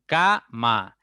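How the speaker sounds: "cama" split into ca - ma; two separate beats in "cama", ca, then ma; syllable by syllable. Shown as a demonstration. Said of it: "Una tiene que ser tónica".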